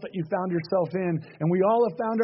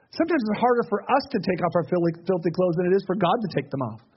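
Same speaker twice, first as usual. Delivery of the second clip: ordinary delivery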